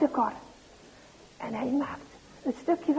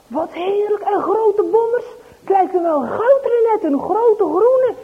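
higher and louder, delighted; excited boy; 7 semitones higher, 7 dB louder than usual. Acted. Dries thrilled to find big apples.

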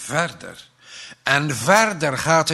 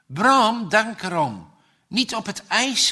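raised warning call; mother calling after him; 7 semitones higher, 4 dB louder than usual. Acted. Mother calls after her son, a raised warning. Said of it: "moeder roept".

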